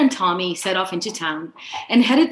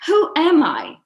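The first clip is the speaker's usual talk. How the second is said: playful quiz; 9 semitones higher than usual. Acted. Playful guessing game with brother.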